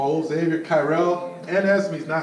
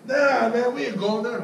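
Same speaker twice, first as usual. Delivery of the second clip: mock customer refusal; 7 semitones higher, 4 dB louder, slower than usual. Voicing fickle customer.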